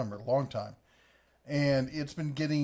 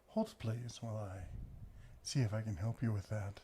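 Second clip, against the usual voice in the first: soft polite receptionist voice; business phone voice; 3 semitones lower, 9 dB quieter than usual. Retold or imitated. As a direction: imitate a hushed customer-service phone voice. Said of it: "your phone voice".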